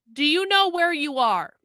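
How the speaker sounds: usual speaking voice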